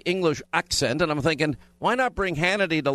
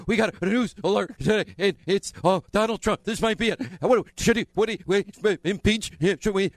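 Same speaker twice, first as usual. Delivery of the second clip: mock giddy newsreader, pitch climbing higher; 3 semitones higher than usual. Host mocks giddy news anchors with rising, breathless excitement. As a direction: ham up breathless glee over impeaching. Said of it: "And then the giddy".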